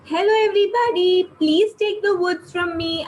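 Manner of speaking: high, loud vendor's call; calling out loudly. Acted. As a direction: call out to the whole street like a wood seller. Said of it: "started shouting loudly".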